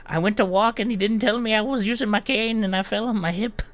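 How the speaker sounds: high, loud, whiny accusation; old gnome voice. Acted. Injured old gnome accusing Moose, high whiny shout. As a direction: point the finger and whine like a hurt old man. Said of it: "points right at Moose and be like".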